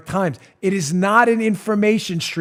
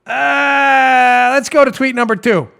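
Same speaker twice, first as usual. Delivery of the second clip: mock exasperation; 8 semitones higher, 6 dB louder, slower than usual.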